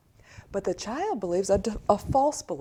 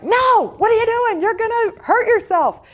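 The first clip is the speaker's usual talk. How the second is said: much higher and louder alarmed yell; retold scream; 14 semitones higher, 12 dB louder than usual. Mother yelling at her toddler near a hot stove, alarmed. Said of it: "I screamed".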